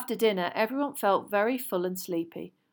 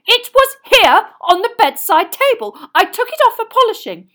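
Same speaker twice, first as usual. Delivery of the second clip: high, very loud angry protest; worked-up, indignant yelling; shouting; 18 dB louder than usual. A narrator's reading. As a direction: shout in outraged accusation. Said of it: "Percy was shouting".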